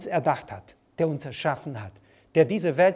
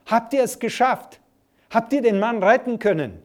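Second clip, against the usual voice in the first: higher and louder call across the water; shouting from the shore; 6 semitones higher, 5 dB louder than usual. Retold or imitated. People on the shore calling out to the boat, loud and high. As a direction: shout out to the returning boat, anxious. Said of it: "riefen die Leute vom Ufer aus".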